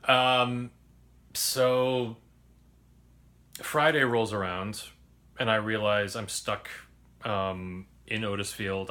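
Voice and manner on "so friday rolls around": usual speaking voice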